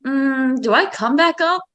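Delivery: hesitant hum, then high rising question; reluctant hesitation. Acted. Clay hides underwater weighing whether to surface, hesitant. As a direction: wonder it aloud, pitch climbing doubtfully. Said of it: "where it's like".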